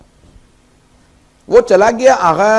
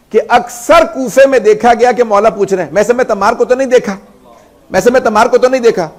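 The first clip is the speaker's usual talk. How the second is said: loud, higher impassioned cry, slowing into anguish; preaching at full force; 6 semitones higher, 7 dB louder than usual. Acted. Preacher voicing the weeping scholar to mourners, impassioned cry. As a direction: lament it to the crowd, voice pushed and aching.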